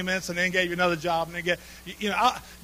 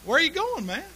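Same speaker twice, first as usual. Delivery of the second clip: friendly neighbor; 7 semitones higher, 8 dB louder, slower than usual. Acted. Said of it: "neighbor comes walking ... and says".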